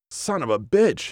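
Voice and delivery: high-pitched scream held back in volume; restrained scream. A narrator's reading. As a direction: cry out high, without full volume. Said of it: "he screamed, punching the wall".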